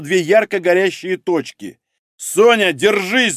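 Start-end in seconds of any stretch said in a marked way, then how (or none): 2.2-3.3 raised, higher warning cry; urgent shout. A narrator's reading. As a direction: call out in alarm, understated shout. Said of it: "закричал он". (тревожный окрик)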